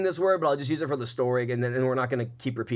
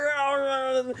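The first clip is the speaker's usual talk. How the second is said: mock hooting; 12 semitones higher, slower than usual. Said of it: "and they're like".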